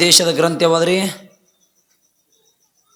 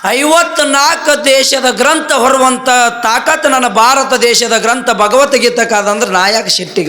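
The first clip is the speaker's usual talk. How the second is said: much higher and louder, proclaiming; proud declaration; 6 semitones higher, 3 dB louder than usual. Acted. Vivekananda telling the foreign monks why he is not angry, proud and louder. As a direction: ring it out with pride.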